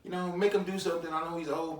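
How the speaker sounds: squeaky put-on voice quoting others; imitating other people. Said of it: "'cause I hear first".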